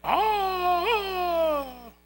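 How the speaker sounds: high, loud hammed-up wail; the crying guard, a hammed-up loud wail; mock crying. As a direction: imitate the guard bawling. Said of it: "Ese guardia está llorando".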